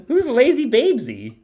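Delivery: high, playful coo; singsong cooing at a baby; cutesy baby talk. Pretend.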